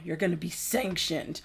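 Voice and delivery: low, soft mock threat; mock egotistical. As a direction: mimic their self-importance, low and quiet. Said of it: "that was just their fucking ego".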